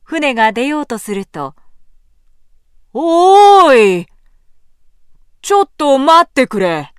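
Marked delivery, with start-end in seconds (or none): 2.9-6.9 loud, slow shout from afar; calling out. A narrator's reading. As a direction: call out to stop the boat. Samurai calling from the bank.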